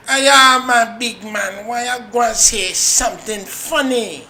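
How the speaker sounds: high, loud taunt; mock a woman calling him out; woman's taunting voice. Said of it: "This bitch said".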